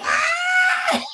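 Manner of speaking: high-pitched squealing laugh; imitated laughing fit. Teacher imitates Johnny bursting out laughing, shrill mock laughter. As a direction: burst out in a mock kid's cackle.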